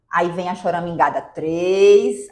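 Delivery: usual speaking voice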